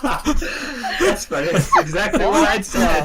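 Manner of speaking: laughing while talking; giggling through the words. Real, not acted. Laughing at the retold promo.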